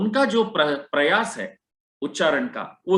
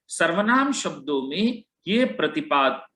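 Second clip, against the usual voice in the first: usual speaking voice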